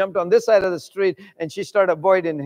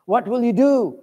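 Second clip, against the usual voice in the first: normal talking voice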